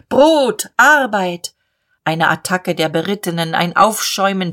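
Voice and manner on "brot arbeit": high, rolling protest call; slogan ringing over the crowd; thundering crowd shout. Acted. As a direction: shout it like a demonstrator. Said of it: "wie aus einer Gewitterwolke".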